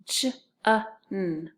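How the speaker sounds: "j-a-n" as separate j, uh, n; tapping out sounds. Shown as a demonstration. Teacher tapping the first syllable, sound by sound. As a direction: sound out "j-a-n" piece by piece. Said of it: "So first syllable".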